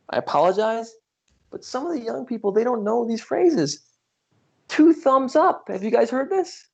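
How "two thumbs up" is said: high, loud showman catchphrase; catchphrase exclaimed. Retold or imitated. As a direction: belt it out like a TV critic. Quoting the show's catchphrase.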